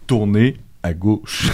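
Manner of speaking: slow robotic navigation voice; GPS voice. Acted. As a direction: read it like a sat-nav. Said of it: "t'as une voix de G P S".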